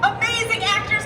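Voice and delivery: ordinary talk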